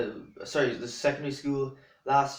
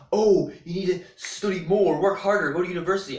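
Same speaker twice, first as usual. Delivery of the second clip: higher, louder mock nag; raised-voice parody of pushy advice; mock nagging; 4 semitones higher, 5 dB louder than usual. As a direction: imitate someone pushing people, stretching the opening words. Mimicking people who push study.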